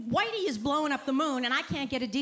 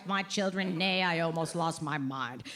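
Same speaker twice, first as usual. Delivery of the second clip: normal talking voice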